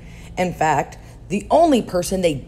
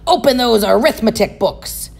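stern order; 5 semitones higher, 5 dB louder than usual. Acted. Stern teacher orders class.

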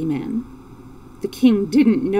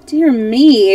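loud, higher startled exclamation; exclaimed; 4 semitones higher, 8 dB louder than usual. A narrator's reading. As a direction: exclaim in mild astonishment. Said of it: "exclaimed Dorothy".

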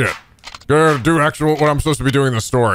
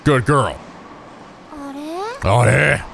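ordinary talk, nothing marked

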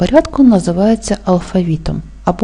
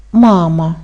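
"мама" with a strong first "ма"; stress on first syllable. Shown as a demonstration. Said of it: "Наголошений перший склад". («мама» з наголосом на перший склад)

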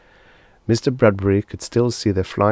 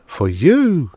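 higher, louder gleeful reveal; grinning; 8 semitones higher, 6 dB louder than usual. A narrator's reading. Oswald showing the lawyer his cage, grinning. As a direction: say it through a grin. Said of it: "grinned Oswald".

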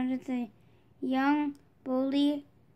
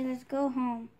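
ordinary delivery

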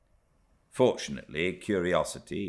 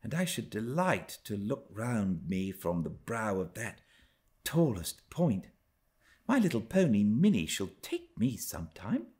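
put-on young Cathy to herself; 5 semitones higher than usual. A narrator's reading. Cathy murmuring to herself about the hills, high wistful voice. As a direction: muse aloud like a longing little girl. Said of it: "she murmured to herself".

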